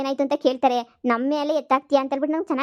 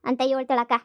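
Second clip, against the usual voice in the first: normal talking voice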